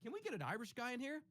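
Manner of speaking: high, bright mock request. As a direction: toss off a squeaky imitation of someone asking. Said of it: "So if you're like".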